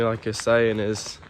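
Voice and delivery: ordinary delivery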